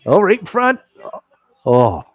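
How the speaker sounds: sudden high loud cry; excited outburst. Real, not acted. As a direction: react to a near goal. Live hockey chance reaction.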